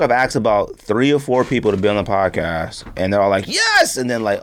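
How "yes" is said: eager yes. Retold or imitated. Imitating eager guests agreeing.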